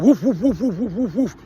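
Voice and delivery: rapid string of spoken woofs; imitated dog bark. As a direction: bark like your dog warning off another. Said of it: "my dog's already like".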